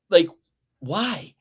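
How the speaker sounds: usual speaking voice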